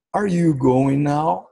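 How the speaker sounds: each word slow and clear for learners; slow model question with a rise at the end; slow teaching read. Shown as a demonstration. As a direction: sound it out slowly, like a teacher. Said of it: "Últimas frases aqui pra recapitular".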